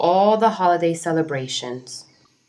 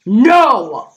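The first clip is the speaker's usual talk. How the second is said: angry no; 5 semitones higher, 8 dB louder than usual. Acted. Jim refuses, then stomps.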